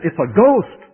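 hammed-up terror, "ghost" rising; frightened cry. Disciples in the boat seeing Jesus, mock frightened cry. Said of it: "And so they said".

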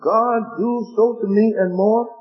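scripture reading. Acted. Preacher reading the Bible to his congregation, measured. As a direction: read the verses out to the congregation.

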